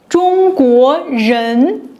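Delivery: 中国人 said slowly, syllable by syllable; slow model word. Shown as a demonstration. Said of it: "Attach it after country's name".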